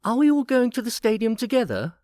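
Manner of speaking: put-on high eager boy voice. A narrator's reading. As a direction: ask like an excited kid. Boy asking excitedly.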